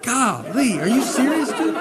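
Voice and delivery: mock shock, voice leaping high. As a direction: pitch jumps up high on golly and serious. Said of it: "You're like".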